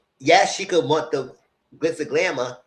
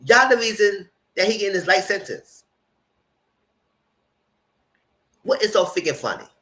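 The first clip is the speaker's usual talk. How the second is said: higher, indignant accusation; heated; 8 semitones higher than usual. Retold or imitated. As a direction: lay the blame on them, raising the pitch. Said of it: "I'm getting heated".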